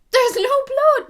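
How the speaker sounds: high, loud astonished squeal; high loud squeal of amazement; shocked squeal. Retold or imitated. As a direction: shriek it in disbelief. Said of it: "I was like".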